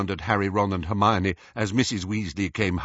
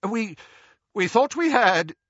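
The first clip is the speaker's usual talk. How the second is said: high, faltering start; alarmed; 12 semitones higher, 3 dB louder than usual. A narrator's reading. Father bursts in on the quarrel, alarmed. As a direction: stumble into it in alarm. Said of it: "looking thoroughly alarmed".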